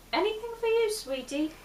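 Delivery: high-pitched waitress voice; waitress taking order. Acted. Waitress asks a customer for the order, high-voiced. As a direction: lift the pitch up high, then ease down.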